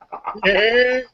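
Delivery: high cartoon chuckle; Peter Griffin laugh. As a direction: imitate a goofy cartoon laugh. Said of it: "when I'm Peter Griffin".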